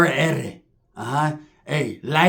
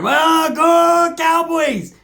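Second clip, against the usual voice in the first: very high, very slow drawn-out loud cry; mock sex cry; 12 semitones higher, 5 dB louder, much slower than usual. Sheila heard through the wall, with a very high drawn-out mock cry. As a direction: wail it long and high. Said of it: "And I can hear".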